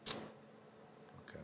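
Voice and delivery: ordinary talk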